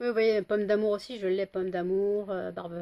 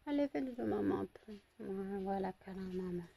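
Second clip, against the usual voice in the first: hushed coaxing; 9 dB quieter than usual. Real, not acted. Lulling the dog to sleep.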